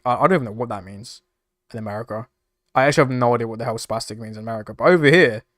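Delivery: ordinary talk, nothing marked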